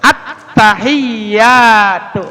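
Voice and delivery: very slow "Attahiyatu" with a long "hi"; long hi, clear tasydid. Shown as a demonstration. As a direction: draw out "hi" and press the y in "Attahiyatu". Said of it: "Panjang haknya". ("Attahiyatu" dengan ha panjang)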